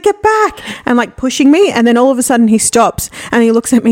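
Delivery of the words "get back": panicked shout. Retold or imitated. Boyfriend in his sleep shouting at her in terror. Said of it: "he's like".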